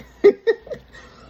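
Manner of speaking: high, loud burst of laughing; a sudden laugh of disbelief; real burst of laughter. Real, not acted. Laughing at the news of three babies, high and loud.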